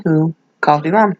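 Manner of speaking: usual speaking voice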